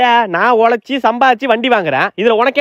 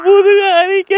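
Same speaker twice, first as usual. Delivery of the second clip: put-on high wailing baby voice, much higher; baby whine; 12 semitones higher, slower than usual. Grown man whining as a baby to his mother. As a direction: whine like a fussy infant. Adult playing crying baby.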